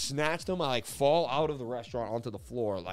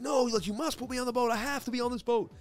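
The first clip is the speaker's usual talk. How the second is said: high, frantic retold insistence; acted-out panicky demand; desperate demand; 13 semitones higher than usual. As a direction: insist at the top of your range. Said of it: "And I just demand".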